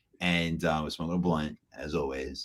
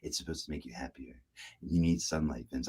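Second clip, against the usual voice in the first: much softer, lower, eerily calm cult voice; 5 semitones lower, 11 dB quieter than usual. Retold or imitated. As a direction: say it softly like a true believer. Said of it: "She was like".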